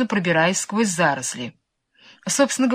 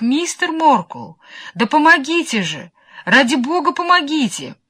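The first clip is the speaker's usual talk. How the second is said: high, loud desperate plea; pleading cry; 7 semitones higher, 3 dB louder than usual. A narrator's reading. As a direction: beg for help in despair. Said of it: "жалобный, умоляющий крик".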